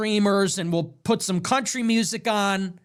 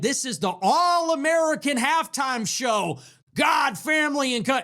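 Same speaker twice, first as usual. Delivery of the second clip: mock proud announcement; 6 semitones higher than usual. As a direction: bellow it like a proud promoter. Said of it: "They said".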